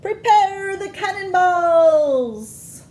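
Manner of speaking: high, loud, very slow battle cry; little pirates. Acted. Little pirates before the plug is pulled, slow loud high battle cry. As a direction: roar it like gunners readying a broadside. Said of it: "say the little pirates".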